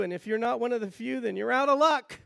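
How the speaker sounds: ordinary delivery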